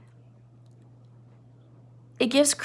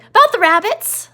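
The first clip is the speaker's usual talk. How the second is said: put-on much higher and louder, childlike excuse; a big kid whining innocently; Lenny; 10 semitones higher, 8 dB louder than usual. Teacher voicing Lenny explaining why he came, high and childlike. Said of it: "He was like".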